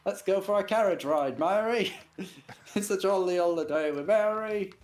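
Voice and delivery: put-on high, jolly old-fashioned English; sing-song cheerful Edwardian charm; Mary Poppins voice. Mary Poppins style quote.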